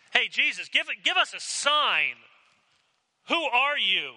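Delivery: mocking demand. Leaders demanding a sign from Jesus, indignant. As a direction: badger him for a sign in affronted tone.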